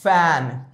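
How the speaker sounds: FAN said low with a falling tone; model pronunciation. Shown as a demonstration. Teacher has learners repeat after her, modelling FAN. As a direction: say FAN clearly as a model word. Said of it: "Repeat after me".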